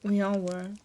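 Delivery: ordinary delivery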